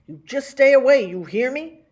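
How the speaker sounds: loud, high command; stern shout. A narrator's reading. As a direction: bark the order at her. Pa orders her, pointing.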